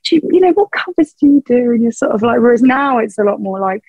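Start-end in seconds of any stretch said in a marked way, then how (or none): 0.0-1.7 patronising relative. Retold or imitated. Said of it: "they're like".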